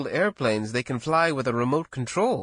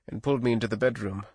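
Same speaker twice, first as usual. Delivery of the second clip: plain reading voice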